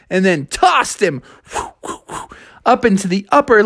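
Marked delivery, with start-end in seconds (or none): 1.5-2.3 soft, airy flight noises; whooshing. A narrator's reading. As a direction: whoosh like something tossed skyward. Bear flying through the air.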